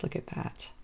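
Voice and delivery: breathy whispered aside of wonder; hushed, almost to herself; awed whisper. Real, not acted. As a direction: whisper it like you can't believe how pretty it is. Admiring crystal clay beads.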